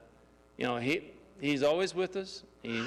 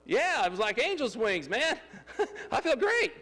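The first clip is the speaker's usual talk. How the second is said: laughing elation; 8 semitones higher than usual. Retold or imitated. Telling the pastor the flight felt great, laughing elation. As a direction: giggle out the joy of flying. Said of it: "I go".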